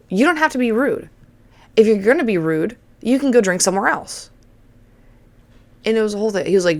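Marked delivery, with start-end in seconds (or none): 0.1-4.3 higher, emphatic reprimand; retold indignant scolding. Bartender warning a rude customer, firm and indignant. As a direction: stand your ground, pitch raised.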